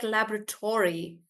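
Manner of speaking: laboratory as LAB-ruh-tor-ee; American pronunciation. Shown as a demonstration. Teacher showing how Americans say it, laboratory as LAB-ruh-tor-ee. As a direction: say laboratory the American way, full "tory" ending. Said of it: "so they would say it like".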